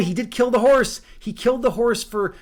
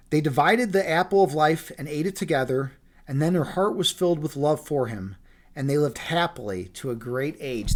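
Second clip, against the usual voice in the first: story narration; 4 semitones lower than usual. A narrator's reading. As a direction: wind the fairy tale down gently. Reads the fairy tale ending.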